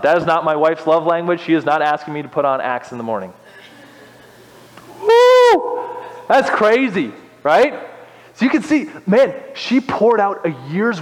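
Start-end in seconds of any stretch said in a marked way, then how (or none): 5.0-6.0 high, loud whoop; sudden high "woo" of amazement. Real, not acted. Reacts to the extravagant act.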